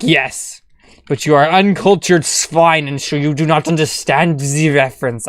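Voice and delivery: a light mock German accent on the reply; lofty, put-on German for the uncultured swine. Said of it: "I don't know why I got German there".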